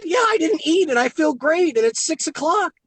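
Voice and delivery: high, bright-eyed amazement; excited roommate. Retold or imitated. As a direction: gush about feeling great. Roommate quoted, thrilled.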